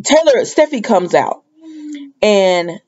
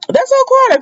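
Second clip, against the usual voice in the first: repeated much higher, emphatic. Real, not acted. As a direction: say it again way up, driving it home.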